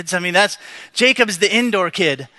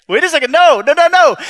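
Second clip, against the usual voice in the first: higher, louder alarmed no no no; panicked protest; 7 semitones higher, 6 dB louder than usual. Retold or imitated. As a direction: refuse in a rising panic. Jacob protests God's command.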